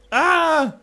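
loud high mock scream of pain; hammed-up scream. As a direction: cry out like a dying hero. Mock dying in game.

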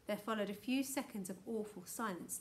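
plain reading voice

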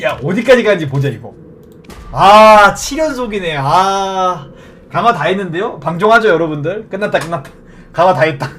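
normal talking voice

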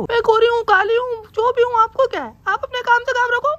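high falsetto wife scolding; mimicking wife. Husband mimics his wife accusing him, high falsetto. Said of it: "mimicry कर रहा हूँ मैं".

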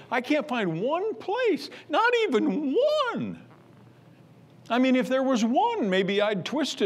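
rising preacher's exclamation; incredulous preaching cry. Acted. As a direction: cry out that there is not one.

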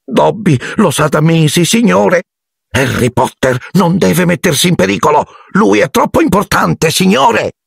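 high-pitched, pleading elf; anxious house-elf. A narrator's reading. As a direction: warn him in a squeaky little voice. Narrator voices Dobby's anxious warning. (elfo angosciato, vocina acuta)

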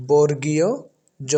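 বর্গীয় জ with a pause before জ; correct letter name. Shown as a demonstration. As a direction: set জ apart after a clear বর্গীয়. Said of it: "সঠিক উচ্চারণটি হচ্ছে".

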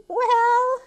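high, loud, stretched word; coy sheep. Acted. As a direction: swoop up high as a flattered sheep. Flattered sheep answers.

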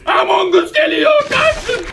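high screaming warning; panicked shriek. Real, not acted. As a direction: scream for everyone to run. Shrieking warning as enemy comes.

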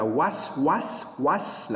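wash said as "wass", with s for sh; wrong final S. Shown as a demonstration. Teacher mimics the mistake, wash ending in S. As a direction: end wash on a plain s instead of sh. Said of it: "wash là sai nè".

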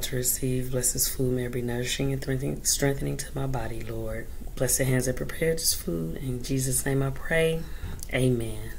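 soft, reverent prayer voice. Real, not acted. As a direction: pray quietly over food. Saying grace before eating.

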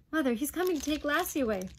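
put-on high, loud, fast boyish outburst; urgent alarm. Boy warns mother the Duke is coming, alarmed. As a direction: blurt it out in alarm.

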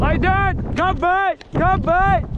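high, carrying dog command called across the hill; calling to dogs. Real, not acted. Shepherd calling his dog to lie down, high and carrying. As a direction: call it out so the dog hears you far off.

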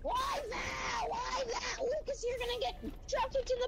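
high loud outraged yell; shouting. Real, not acted. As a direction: shout it out in disbelief. Shouting at teammate in game.

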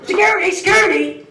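hammed-up woman yelling for security; mock shrieking woman.

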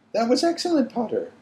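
high, excited stern teacher; crying out praise. A narrator's reading. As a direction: burst out with rare praise. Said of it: "cried Professor McGonagall".

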